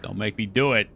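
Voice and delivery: normal talking voice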